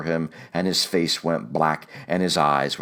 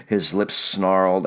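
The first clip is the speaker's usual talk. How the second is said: usual speaking voice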